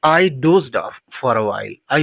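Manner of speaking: slow, careful model sentence. Shown as a demonstration. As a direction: say it slowly for learners. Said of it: "तो". (धीमे, साफ़ उच्चारण में वाक्य)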